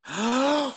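sharp high intake of breath before holding it; a hammed-up gasp; big dramatic gasp. Mocking the woman by sucking in a big breath. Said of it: "And I go".

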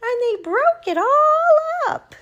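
very high, wailing cub voice; squeaky, loud baby bear cry; wee small Baby Bear. Acted. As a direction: wail it as a tiny bear, stretching the end. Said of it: "in his wee small voice".

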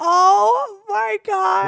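high, slow, tearful laughing gasp; laughing so hard it turns to tears; crying with laughter. Real, not acted. Wife cracking up at the story, crying with laughter.

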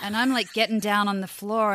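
ordinary talk

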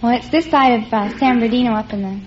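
ordinary talk, nothing marked

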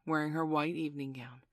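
ordinary delivery, nothing marked

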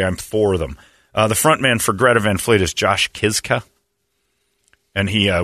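ordinary talk

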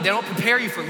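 ordinary talk

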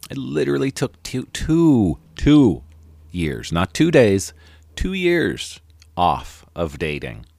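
each "two" hit high and loud; drawn-out emphatic "two years"; stress on two years. Real, not acted. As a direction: hammer "two" home, then slow on "years". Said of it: "I'm gonna say it again".

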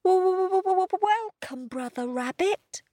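high, stuttering scared puppy; nervous stutter. A narrator's reading. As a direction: stammer it out, frightened.

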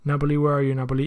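usual speaking voice

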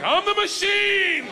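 high, slow, loud bellowing boast; shouted boast. Retold or imitated. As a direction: belt it out like a party chant. Drunk gangsters chant his phrase.

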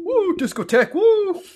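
excited whooping. Real, not acted. Party cheering.

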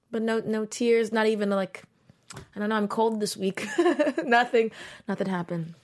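ordinary talk, nothing marked

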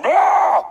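loud, high burst of imitated coughing; mock hacking cough. Preacher mimicking a coughing airline passenger, mock hacking. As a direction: cough out a "bruh" noise in exaggerated imitation. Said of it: "like, coughing his head off".

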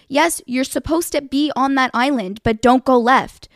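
loud retold directions, hammering each one; frustrated, emphatic instructions. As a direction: raise your voice in exasperation. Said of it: "I was raising my voice".

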